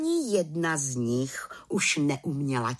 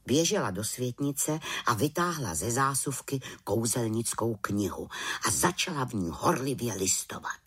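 usual speaking voice

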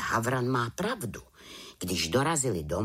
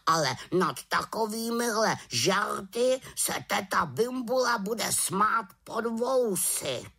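huffy raven in a raised voice; uraženě; 10 semitones higher than usual. A narrator's reading. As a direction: warn her as an affronted raven, drawing out the end. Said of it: "urazil se Abraxas". (uražené havraní varování)

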